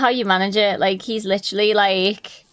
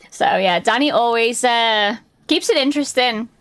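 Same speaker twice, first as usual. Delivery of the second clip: ordinary talk, nothing marked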